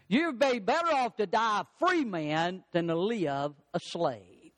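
ordinary talk, nothing marked

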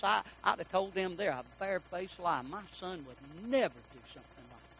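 soft, hushed, heartbroken father; father crying. Retold or imitated. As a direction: speak softly as a tearful dad. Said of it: "my dad begins to cry".

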